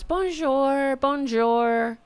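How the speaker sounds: high, sing-song bonjour; mock French greeting. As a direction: imitate her greeting in a drawn-out singsong. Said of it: "she goes".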